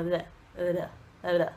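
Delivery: "Blá" as a low, slack "da"; low repeated "Blá" with barely touched D; lazy D. Shown as a demonstration. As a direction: lazily soften the D in each "Blá". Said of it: "com preguiça de falar o D".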